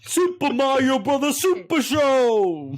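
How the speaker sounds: put-on high, slow hammy Italian accent. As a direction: sing it out like a cartoon Italian. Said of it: "your fucking Italian accent".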